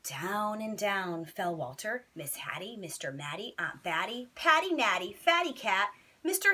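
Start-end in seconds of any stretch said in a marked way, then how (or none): none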